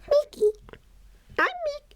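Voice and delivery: failed attempt at a falsetto cartoon mouse; Mickey Mouse. Shown as a demonstration. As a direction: squeak it very high, not quite convincingly. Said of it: "No, that's not. I can't do it".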